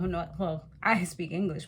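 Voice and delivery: ordinary talk, nothing marked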